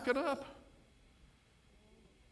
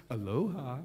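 lightly voiced local greeting; 9 semitones lower than usual. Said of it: "And they are".

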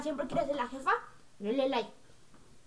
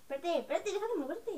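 higher, softer mock pleading; imitating a friend; 5 semitones higher, 5 dB quieter than usual. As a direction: mimic a friend telling someone off. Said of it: "ella me dice".

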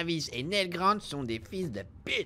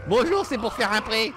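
loud, higher polite customer voice; mock bank customer; 6 semitones higher, 7 dB louder than usual. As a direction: play a cheery bank client. Jokes to empty bank counter.

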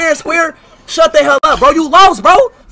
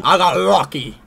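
a much lower, sneering echo of his taunt; mocking the loser; 13 semitones lower, 6 dB quieter than usual. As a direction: throw his line back at him, lower. Mocking opponent's taunt again.

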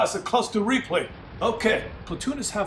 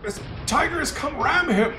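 ordinary talk, nothing marked

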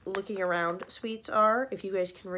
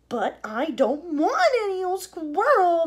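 high, loud, slow whine; bratty shout; 9 semitones higher, 9 dB louder, slower than usual. Acted. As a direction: yell back at mom. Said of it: "Veruca shouted".

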